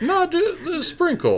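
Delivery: high laughing protest; laughing it off with a squeak. Real, not acted. Teasing teammate about sprinkles, laughing. Joking about plain deck.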